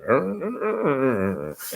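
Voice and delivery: short growl in an odd, altered voice; grumbling growl. Retold or imitated. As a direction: growl back at the insulting dentist. Reacting to mom insult.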